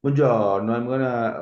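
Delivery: hammed-up Italian greeting; exaggerated Italian accent. Shown as a demonstration. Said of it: "I don't consider that is".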